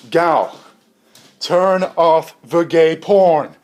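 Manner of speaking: loud, slow threatening ultimatum; scientist's ultimatum. Acted. Scientist threatening his AI over the headset, loud and slow. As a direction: deliver the last warning slowly. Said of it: "talking on his Turtle Beach".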